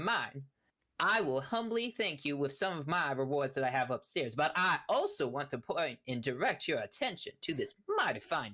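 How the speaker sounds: folksy, hammed-up country gentleman; long-winded half-orc rancher. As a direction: ramble on courteously in a country voice. GM plays Big Al rambling.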